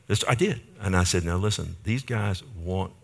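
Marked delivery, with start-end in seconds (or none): 1.2-2.9 low, softer urgent aside; hushed warning. Retold or imitated. Husband quietly warning his wife about the men in the booth, hushed. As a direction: warn her under your breath.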